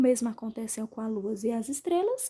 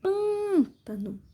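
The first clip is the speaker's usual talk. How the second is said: "Pântano" with PÂN stretched long and high; stress on PÂN; 7 semitones higher, much slower than usual. Shown as a demonstration. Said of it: "Sílaba mais forte é o pan".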